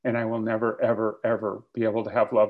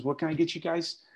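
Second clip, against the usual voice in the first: waiter voice; 5 semitones higher than usual. Retold or imitated. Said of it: "He says".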